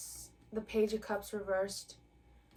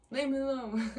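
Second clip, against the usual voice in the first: laughing through it; 4 semitones higher than usual. Retold or imitated. Laughing through the quoted line.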